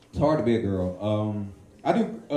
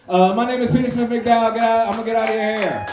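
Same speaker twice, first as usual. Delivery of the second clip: emcee sign-off; 10 semitones higher, 7 dB louder than usual. Real, not acted. Comic signs off to crowd.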